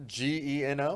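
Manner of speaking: G E N O spoken letter by letter; spelling it out. Shown as a demonstration. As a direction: spell G E N O one letter at a time. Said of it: "Can you spell it for me".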